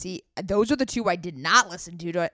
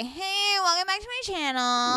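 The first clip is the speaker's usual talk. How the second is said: much higher, chirpy youtuber greeting; bright youtuber intro, pitched way up; 10 semitones higher than usual. Retold or imitated. Mocking youtubers for her cohost, much higher. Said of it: "we're the type of people that say".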